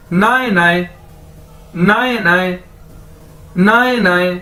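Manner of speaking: नाए नाए repeated clearly, even syllables; teacher repeating नाए नाए; pronunciation demo. Shown as a demonstration. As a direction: drill नाए नाए for grandmother. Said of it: "तो अगर इसको दो बार बोला जाए".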